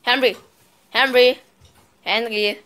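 loud call across the room. Real, not acted. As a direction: shout his name to find him. Calling brother Henry. (laut nach Henry rufen)

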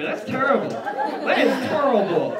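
put-on loud, high old-lady scolding.

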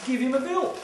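rousing emphatic repeat. Real, not acted. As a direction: punch it out to the room. Repeated advice, building to a climax.

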